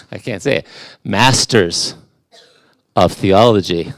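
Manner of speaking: usual speaking voice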